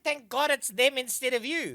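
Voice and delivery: correcting the quote with force; insisting on the right words. Retold or imitated. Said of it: "No, wait, they said".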